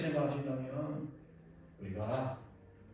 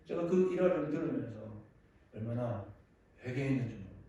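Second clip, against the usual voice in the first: ordinary talk, nothing marked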